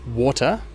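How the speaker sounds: "water" with a strong T and no final R; "water" with T sounded, R silent; British water. Shown as a demonstration. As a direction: say "water" the British way, as "waw-tuh". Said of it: "with a strong T and no R".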